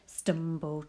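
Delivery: plain reading voice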